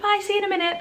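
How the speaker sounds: bright, high, forced cheerful goodbye; putting on a brave face. Retold or imitated. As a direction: sound happy and strong for her. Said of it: "being really, like, happy and strong".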